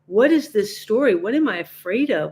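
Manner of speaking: ordinary talk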